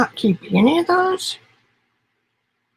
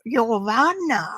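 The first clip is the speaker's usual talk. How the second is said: Arkansas Italian accent; slower than usual. Shown as a demonstration. Painter repeating the name for viewers in an Arkansas Italian accent. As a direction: croon Giovanna in a playful Arkansas Italian accent. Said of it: "That's my Arkansas Italian accent".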